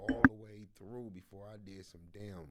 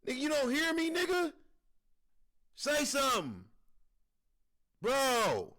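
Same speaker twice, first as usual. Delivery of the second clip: high, loud call to a silent brother; mock indignant calling; 13 semitones higher, 6 dB louder, slower than usual. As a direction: demand an answer, pitched way up. Acts calling to the silent twin.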